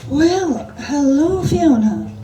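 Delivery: loud, high, stretched-out Scottish drag queen greeting; breathy drawl. A narrator's reading. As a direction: draw out the hello like a flirty diva. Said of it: "in a breathy drawl".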